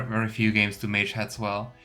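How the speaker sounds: normal talking voice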